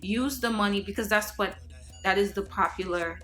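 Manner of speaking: ordinary talk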